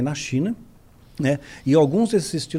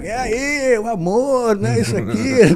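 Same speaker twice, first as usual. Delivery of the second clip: bikers cheering, laughing; 5 semitones higher, 3 dB louder, slower than usual. Retold or imitated. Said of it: "Aí os motoqueiros tão passando".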